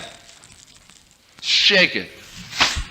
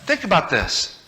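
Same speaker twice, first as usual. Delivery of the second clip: higher eager coaxing; pleading kid; 13 semitones higher than usual. Retold or imitated. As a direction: beg him to go along. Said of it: "I said".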